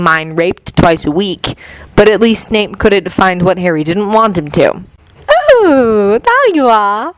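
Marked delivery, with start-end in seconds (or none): 5.6-7.1 put-on lightly sung, girlish lilt; a hint of a singsong child; childish sing-song. A narrator's reading. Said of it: "came a childish sing-song voice".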